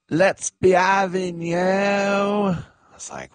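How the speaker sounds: high, drawn-out imitation of a crowd rally. Host imitates famous cook to a stadium crowd, high rallying call. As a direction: call it out high and stretched, mimicking her. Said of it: "she got on the microphone to the crowd".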